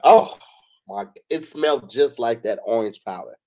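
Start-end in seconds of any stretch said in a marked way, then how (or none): 0.1-1.1 mock-appalled outburst; retold disgusted reaction. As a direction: cry out as if hit by the smell. Retold reaction to smelling candle.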